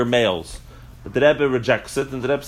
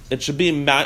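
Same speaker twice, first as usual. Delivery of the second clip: ordinary talk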